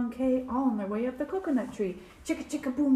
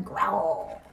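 bear growl; 7 semitones lower than usual. A narrator's reading.

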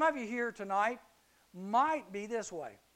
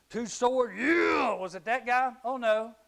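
loud, higher mock-tough shout; excited shout; 4 semitones higher, 8 dB louder, slower than usual. Preacher picturing a tough Roman soldier, loud shout.

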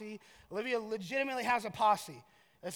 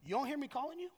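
soft but higher, rising challenge; leading parental question; 4 semitones higher, 5 dB quieter, faster than usual. Shown as a demonstration. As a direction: corner the kid with an accusing question. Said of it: "automatically put the kid, kid in the corner".

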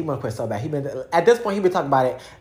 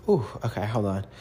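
out of breath; 6 semitones lower than usual. Real, not acted. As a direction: catch your breath between words. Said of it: "Got out of breath".

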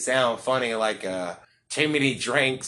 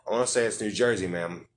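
usual speaking voice

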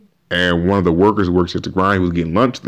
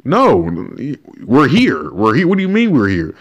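indignant; 5 semitones higher than usual. Real, not acted. Indignant reply to go back.

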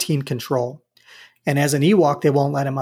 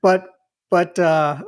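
usual speaking voice

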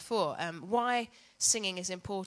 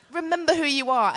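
loud, higher mock lion boom; a second hammed-up lion line, louder and higher; Mufasa lion voice; 7 semitones higher, 10 dB louder than usual. Mufasa telling Simba to remember, loud high mock lion voice. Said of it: "That was my lion voice".

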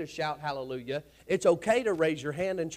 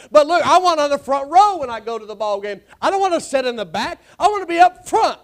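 loud, fired-up sermon; full-force preaching; 8 semitones higher, 12 dB louder than usual. Real, not acted. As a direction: preach it hard to wake the pews.

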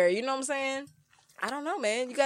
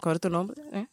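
Spanish flirting; 4 semitones lower, faster than usual. Retold or imitated. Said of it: "That accent come out".

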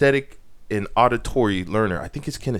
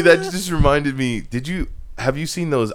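normal talking voice

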